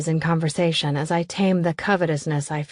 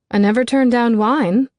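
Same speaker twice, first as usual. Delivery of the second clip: higher and louder, bubbly; cheerful eager acceptance; perky Davina; 5 semitones higher, 6 dB louder than usual. A narrator's reading. Davina accepting wine from Elizabeth, bubbly.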